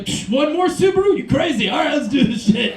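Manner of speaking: hammed-up pre-date psych-up; mock self-hype. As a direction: egg yourself on like a rowdy drinker. Acts hyping himself up alone.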